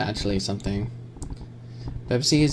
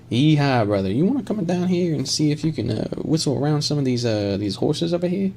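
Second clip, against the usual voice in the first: cowboy voice; 3 semitones higher than usual. Acted. Streamer invites a brother to the horses, cowboy voice. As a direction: play a cowboy calling a brother over. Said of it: "Can I do a cowboy voice?".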